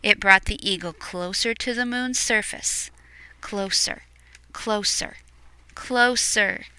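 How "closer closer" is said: ordinary delivery, nothing marked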